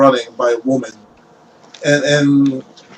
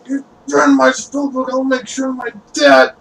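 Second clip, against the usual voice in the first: high-pitched whiny threat; spoiled captive, voice pitched way up; petulant necromancer; 10 semitones higher than usual. Acted. Necromancer threatens the cleric with his dad, petulant high voice.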